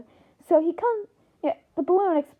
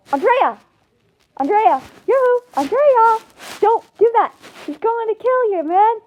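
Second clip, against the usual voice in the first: high, loud warning shouts; urgent calling out; 7 semitones higher, 5 dB louder than usual. Acted. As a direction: call out to stop someone in danger.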